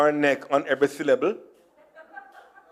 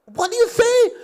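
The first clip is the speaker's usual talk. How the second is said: high-pitched, puzzled shop lady; mock the lady's incredulous question, pitched up high; baffled shop lady. Shop lady not understanding a customer's request, mock bafflement.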